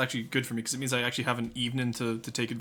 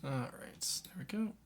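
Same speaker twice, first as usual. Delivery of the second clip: soft unvoiced whisper; 9 dB quieter, slower than usual. Real, not acted. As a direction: breathe it under your breath. Quiet moment while digging.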